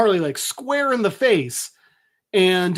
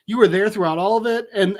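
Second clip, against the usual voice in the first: ordinary talk, nothing marked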